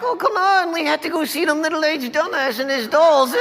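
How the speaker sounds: high, whining complaint; whiny wife. Retold or imitated. Wife complaining to husband about the show, high and whiny. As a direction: moan like a dragged-along wife. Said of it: "whose wives are like".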